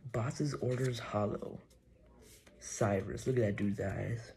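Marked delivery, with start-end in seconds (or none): none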